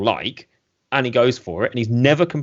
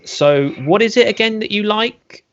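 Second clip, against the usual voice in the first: retold shopkeeper's strained patience, higher and drawn out; 9 semitones higher than usual. Shopkeeper re-asking a picky customer, with strained patience. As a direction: ask slowly, pitched up, as if patience is thinning.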